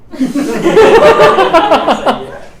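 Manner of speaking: loud high laugh. Real, not acted. Teacher laughing at the village boy's reply, loud laugh. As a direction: burst out laughing at the tale.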